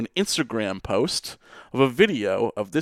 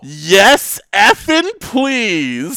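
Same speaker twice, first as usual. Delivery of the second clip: excited tweet read; 7 semitones higher, 6 dB louder, slower than usual. Retold or imitated.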